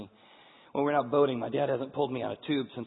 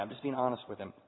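usual speaking voice

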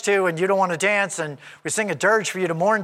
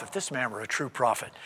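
lower, softer private thought; inner thought; 7 semitones lower, 7 dB quieter than usual. Retold or imitated. The Pharisee silently judges Jesus, lower and softer. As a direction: muse it to yourself, under the breath. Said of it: "thinking to himself".

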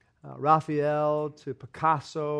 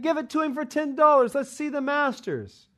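much higher, louder, jeering crowd voice; impatient crowd; 11 semitones higher, 5 dB louder than usual. A narrator's reading. Bored collectors heckle the auctioneer, high and jeering. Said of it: "People were saying".

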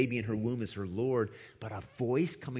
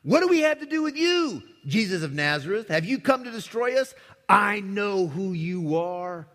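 demon crying out; 6 semitones higher, 5 dB louder than usual. Acted. Demon crying out at Jesus of Nazareth, high and loud. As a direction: shriek at Jesus like a possessed man. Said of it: "he cried out with a loud voice".

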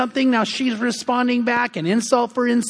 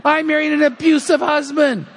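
higher-pitched, hammed-up wail of a wronged wife; mock tearful outburst; 6 semitones higher than usual. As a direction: melodramatic imitation of an upset wife. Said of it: "She breaks into tears".

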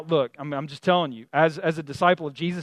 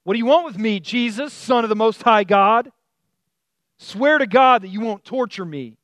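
higher, louder reading of a desperate cry; mild retold shout; 4 semitones higher, 6 dB louder than usual. A narrator's reading. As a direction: raise the voice for the possessed man's plea. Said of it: "He shouted at the top of his voice".

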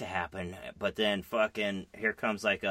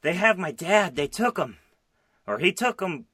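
higher, slightly louder put-on panicked girl; hammed-up distress, lightly voiced; mock Jesse; 9 semitones higher, 6 dB louder than usual. Retelling the finale to a friend, playing Jesse mildly upset. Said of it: "And she's all like".

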